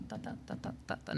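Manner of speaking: whispered "tá, tá, tá" in the tune's rhythm. Shown as a demonstration. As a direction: breathe the beat softly. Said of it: "qual que é o ritmo dessa melodia". ("tá, tá, tá" sussurrado no ritmo)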